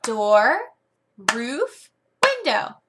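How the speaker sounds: clear, sing-song teacher drill; word drill. Shown as a demonstration. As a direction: lead the pupil through the words. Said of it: "and say".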